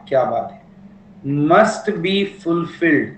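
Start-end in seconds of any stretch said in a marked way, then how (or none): 1.5-3.1 slow dictation. Shown as a demonstration. Teacher finishing the dictated point.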